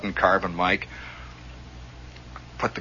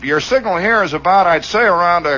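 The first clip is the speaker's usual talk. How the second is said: higher, put-on operator voice; famous radio ham; 6 semitones higher, 5 dB louder than usual. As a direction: brush off the caller over the radio. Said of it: "he comes back to me and he says".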